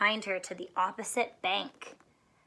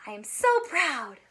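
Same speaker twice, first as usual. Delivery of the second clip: sighing, a touch of pride; mild proud sigh. Shown as a demonstration. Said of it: "what you would look like when you're proud".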